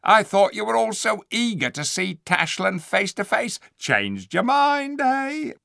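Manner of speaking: high, loud scheming ape voice; sly ape. Acted. The ape answering the dwarfs, sly and loud. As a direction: slick and sneering.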